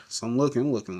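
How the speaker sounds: ordinary talk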